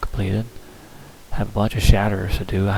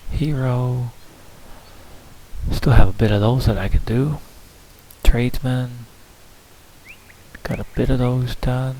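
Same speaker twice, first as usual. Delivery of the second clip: usual speaking voice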